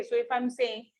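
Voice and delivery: ordinary talk, nothing marked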